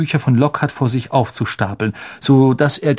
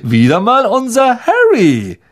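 high, loud condescending showman; patronizing boom; 7 semitones higher, 4 dB louder, slower than usual. Acted. Lockhart claims Harry before the crowd, booming. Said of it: "dröhnte gönnerhaft".

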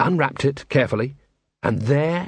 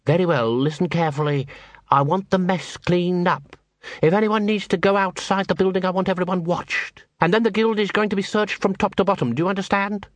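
higher-pitched voice issuing firm orders; stern instructions, pitched up; exacting guild master; 5 semitones higher than usual. Plays Cruces giving orders.

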